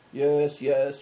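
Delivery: ordinary delivery, nothing marked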